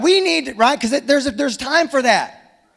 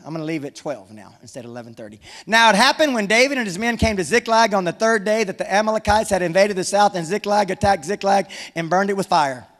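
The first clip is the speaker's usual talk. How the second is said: ordinary talk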